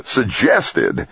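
sharp incredulous snap; snapped. A narrator's reading. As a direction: snap the word back in disbelief. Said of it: "Trumbull snapped".

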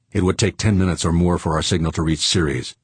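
ordinary delivery, nothing marked

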